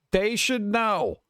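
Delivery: "They should know" drawn out slowly, each word weighted; slow emphatic repeat. Shown as a demonstration.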